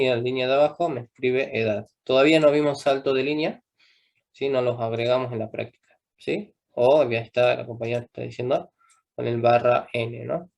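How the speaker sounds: usual speaking voice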